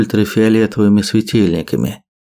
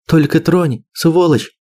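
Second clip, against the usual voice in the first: higher, tearful threat; choking on tears; 8 semitones higher than usual. Acted. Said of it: "захлебнулась слезами Лера".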